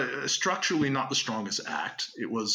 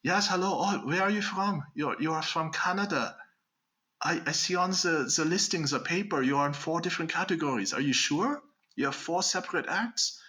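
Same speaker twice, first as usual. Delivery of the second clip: mock German accent, a bit higher; Hollywood German accent; 6 semitones higher than usual. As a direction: ham up a Hollywood German official. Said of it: "I gotta switch to my Hollywood German".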